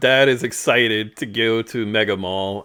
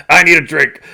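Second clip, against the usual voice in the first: cranky demand; 4 semitones higher, 10 dB louder than usual. Retold or imitated. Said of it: "cranky and overwhelmed, and he was like".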